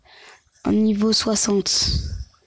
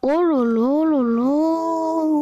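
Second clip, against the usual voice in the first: slow, long exasperated groan of words; drawn-out exasperation; 3 semitones higher, much slower than usual. Real, not acted. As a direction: stretch it out in dismay. Frustrated by tough boss.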